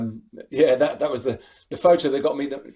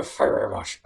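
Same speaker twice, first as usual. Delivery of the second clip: Tommy Cooper; 4 semitones lower, slower than usual. Retold or imitated. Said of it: "Bit of Tommy Cooper".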